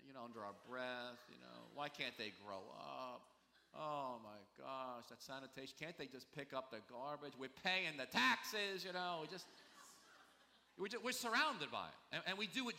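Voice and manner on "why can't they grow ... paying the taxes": slow, drawn-out exasperated grumble; complaining with attitude. Acted. New Yorker griping about garbage pickup, slow exasperated grumbling. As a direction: play up the weary New York complaint. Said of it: "we complain, we have attitude".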